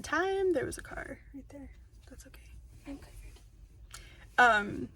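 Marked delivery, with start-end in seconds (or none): 0.5-3.4 whispering. Real, not acted. Reacting to a car.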